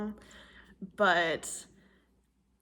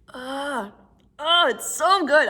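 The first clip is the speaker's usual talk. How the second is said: delighted sigh; 6 semitones higher, 11 dB louder, slower than usual. Real, not acted. Savoring popcorn after a bite.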